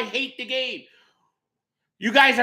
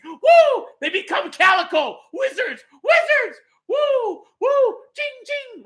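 mock wizard whoops; 11 semitones higher, slower than usual. Mocking magic goalie saves.

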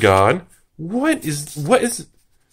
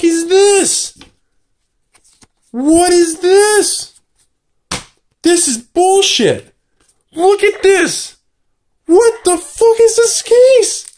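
high, drawn-out cries of disbelief; astonished freak-out; 11 semitones higher, 3 dB louder, slower than usual. Real, not acted. Opener hits a huge card, slow high-pitched freak-out. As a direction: stretch each word out, climbing higher and higher.

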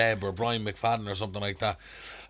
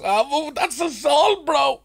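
put-on high, louder drunk fretting; worried drunk mate; 5 dB louder, much slower than usual. Drunk friend fearing arrest, high fretful voice. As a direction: whine it up high like a scared drunk.

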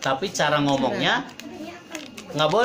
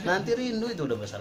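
higher, softer mock-mother warning; mother's admonition; 4 semitones higher, 6 dB quieter than usual. Mother telling squabbling siblings about later longing, admonishing. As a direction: imitate a mother gently cautioning. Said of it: "Ibu saya itu bilang".

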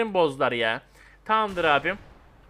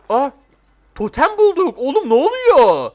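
much higher, loud cry of surprise; startled shout; 7 semitones higher, 8 dB louder, slower than usual. Real, not acted. Player stumbling on a hidden totem, startled shout. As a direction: blurt it out, thrown by what you found.

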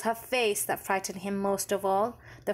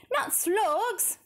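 put-on boy crying out; 13 semitones higher than usual. A narrator's reading. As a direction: cry it out in disgust. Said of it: "George cried out".

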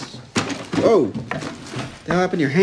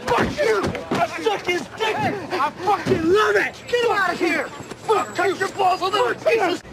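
very high, furious yelling; defiant screaming; 12 semitones higher than usual. Acted. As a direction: hurl each taunt as a shout. Same screaming quarrel continues.

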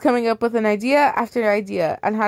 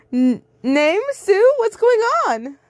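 much higher, worried call; higher, louder worried call from friend; worried girl's cry; 13 semitones higher, 4 dB louder, slower than usual. Acted. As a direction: Lysira calling out, anxious. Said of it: "cried a voice".

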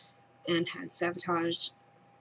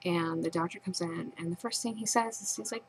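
usual speaking voice

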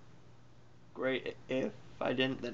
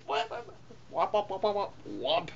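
high imitated whop-whop sound effect; whopping noise; 7 semitones higher, slower than usual. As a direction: mimic a repeated whopping noise, pitched up.

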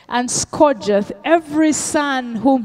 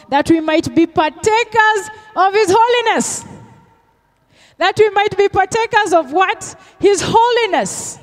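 higher, driving preacher's call; preaching at full force; 7 semitones higher, 3 dB louder than usual. Acted. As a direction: press the point home to the church. Sermon climax on holiness.

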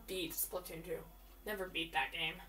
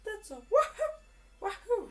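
much higher, cheery Mario cry; 11 semitones higher, 5 dB louder, slower than usual. Pretend. Gamer imitating Mario's catchphrase, high and cheery. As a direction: chirp it like Mario with a long wahoo. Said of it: "Mario, what do you say?".

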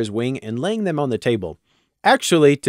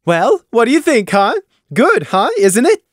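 high, loud, fishing for praise; eager mayor; 10 semitones higher, 6 dB louder than usual. Acted. Taffy asks Crimson, high and eager. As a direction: push for a compliment.

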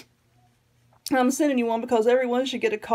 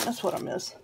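wistful whisper; 8 dB quieter than usual. Real, not acted. Missing her rose garden.

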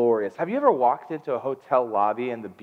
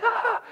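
very high mock scream; short, high yelp of panic; panicked yelp. Husband as the monitors start beeping, panicked yelp. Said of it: "started freaking out".

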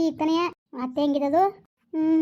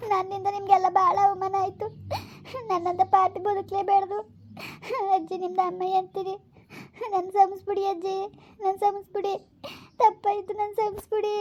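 high, crying plea for forgiveness; tearful pleading; 4 semitones higher than usual. Acted. Granddaughter begging grandmother's forgiveness, crying. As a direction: beg through sobs. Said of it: "ಅಳ್ಬೇಡ ಸುಮ್ಕಿರು".

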